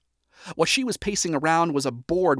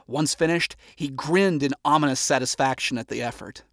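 ordinary delivery

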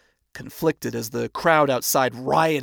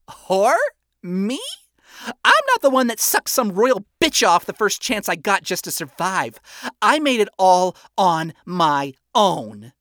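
loud, mockingly offended; condescending false offense; 7 semitones higher, 6 dB louder than usual. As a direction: act fake insulted, looking down. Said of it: "condescending false offense".

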